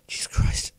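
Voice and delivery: sighing relieved whisper. Real, not acted. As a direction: breathe it out in a whisper. Relief after reaching home.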